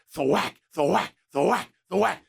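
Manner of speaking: mock thwack of hands on pads; imitated thwack of pad hits; pad-smacking noise. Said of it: "and it was like".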